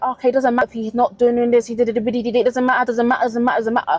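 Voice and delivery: mock dismissive woman. Woman brushing off man's shortcomings.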